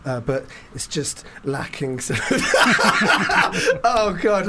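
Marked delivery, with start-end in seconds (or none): 2.2-3.8 burst of laughter. Real, not acted. Laughs mid-sentence. 3.8-4.5 laughing. Real, not acted. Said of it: "I couldn't get through the sentence".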